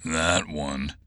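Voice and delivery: a short gruff grunt. Acted. Oates points Garcia out to Fetching with a grunt. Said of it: "Oates grunted".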